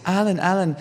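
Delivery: high eager calling of a name; fan calling out. Retold or imitated. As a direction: shout to catch his eye. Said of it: "someone will say".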